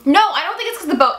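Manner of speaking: ordinary talk, nothing marked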